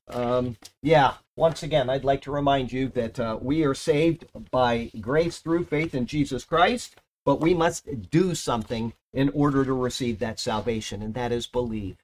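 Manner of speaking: normal talking voice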